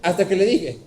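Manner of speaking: ordinary delivery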